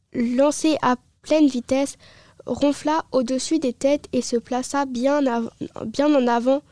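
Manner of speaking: usual speaking voice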